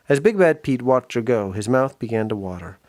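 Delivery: usual speaking voice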